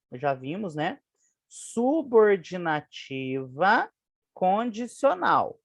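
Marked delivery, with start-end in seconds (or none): 1.5-5.6 "Subordinativa condicional" stretched syllable by syllable; slow dictation. Shown as a demonstration. Teacher naming the type of conjunction, slow measured syllables. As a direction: spell it out slowly, syllable by syllable.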